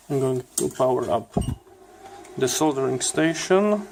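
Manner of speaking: normal talking voice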